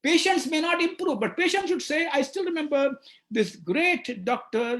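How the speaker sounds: ordinary delivery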